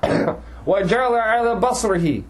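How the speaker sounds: fast, chanted Quranic recitation set apart from his talk; tajweed recitation. Acted. Teacher reciting the verse before explaining it, in a chanted tajweed voice.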